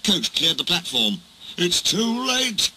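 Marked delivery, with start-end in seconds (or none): 1.6-2.7 high, slow, drawn-out groan; groaned despair. A narrator's reading. Duck groaning as the crash comes, despairing. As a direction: groan it out as all hope goes. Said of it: "Duck groaned".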